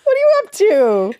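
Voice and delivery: excited. Acted. Calling out playfully to Benza.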